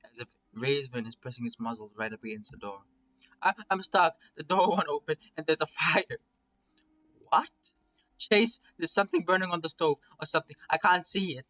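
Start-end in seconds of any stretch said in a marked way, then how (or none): 3.4-11.4 muffled panic. Acted. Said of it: "the voice grows muffled".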